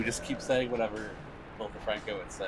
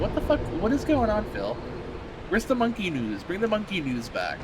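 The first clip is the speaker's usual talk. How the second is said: much higher, louder cry of disbelief; mock outraged disbelief; 11 semitones higher, 5 dB louder than usual. Streamer begging Phil for the monkey news, hammed-up outrage. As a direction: squawk it, then plead. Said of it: "I'm like".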